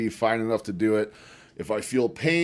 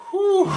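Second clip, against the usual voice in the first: relieved whew; 8 dB louder than usual. Real, not acted. Catching breath.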